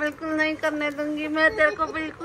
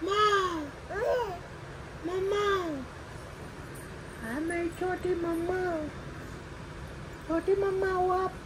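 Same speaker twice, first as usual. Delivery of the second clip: tender cooing; 5 semitones higher, 9 dB quieter than usual. Real, not acted. Cooing to baby daughter.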